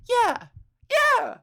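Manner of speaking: very high, loud cartoon yelps; voice-acting demo. Shown as a demonstration. Showing off voice acting, high punchy cartoon squeals. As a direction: squeak out each yeah like a cartoon. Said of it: "I could do voice acting".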